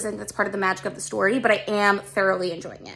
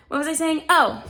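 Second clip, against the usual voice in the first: ordinary talk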